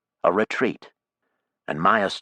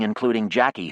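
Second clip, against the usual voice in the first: usual speaking voice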